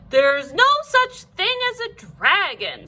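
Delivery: high, firm and certain; firm denial. A narrator's reading. Mother insisting to her son, firm denial. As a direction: say it like you mean it. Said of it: "she said it like she meant it".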